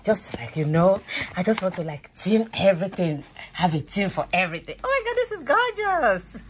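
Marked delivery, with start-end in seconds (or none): 4.8-6.4 high, thrilled gasp of delight; sudden high-pitched excitement; delighted squeal. Real, not acted. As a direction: squeal at how pretty it is. Unboxing her birthday table tent.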